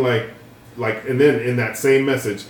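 ordinary talk

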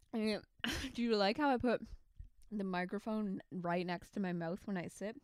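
ordinary talk, nothing marked

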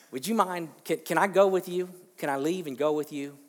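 excited pleading man; eager plea. Retold or imitated. Healed man pleading with Jesus.

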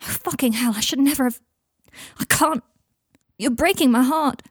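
high, anguished plea; heartbroken, voice pushed up high; on the verge of tears. Acted. Michael heartbroken at Draco, close to tears. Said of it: "Michael was on the verge of tears".

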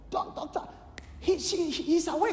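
high, excited nurse calling the doctor; voiced nurse. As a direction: squeal it out like a startled nurse.

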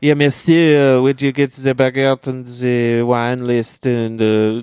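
mock French accent, slow and condescending; a light French accent, looking down on the American; French accent of a rude maitre d'; snooty French waiter. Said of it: "is just being a total dickhead".

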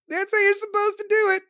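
high-pitched acted exclamation; mock cheer. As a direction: crow it in a high voice. Acts out the cop hitting someone.

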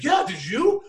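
high, mock-thrilled exclamation; excited retelling. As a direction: throw the question back, high and excited. Retold friend's excited reply.

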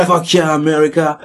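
hammed-up American cheer; mock cheering American. Mocking how Americans cheer each other on, hammed-up cheer. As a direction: pump it up like a proud patriot. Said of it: "the American would say".

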